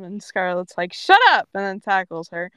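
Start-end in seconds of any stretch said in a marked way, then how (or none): 0.9-1.5 high, loud shut up; sudden burst, louder and higher; acted yell. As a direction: shout it as a flustered comeback. Scarlett yells, then tackles.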